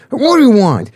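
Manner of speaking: defiant retold demand, pitched high; confrontational challenge. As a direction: throw it at them like a challenge. Confronting intruders, repeated in story.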